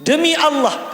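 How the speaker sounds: high, loud sworn oath; emphatic oath. Retold or imitated. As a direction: proclaim it like a retold solemn vow. Acted oath of the imam.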